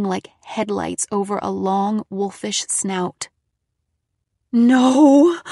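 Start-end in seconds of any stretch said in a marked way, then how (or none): 4.5-5.4 horrified cry. A narrator's reading. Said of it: "A low moan escaped my throat".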